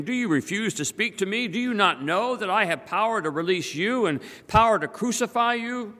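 high-pitched indignant questioning; indignant challenge. A narrator's reading. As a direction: demand an answer from a silent prisoner. Pilate challenging silent Jesus in reading.

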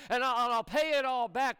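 high, insistent acted promise; pleading promise. Slave promising the king full repayment, pleading. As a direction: promise it eagerly, pitched high.

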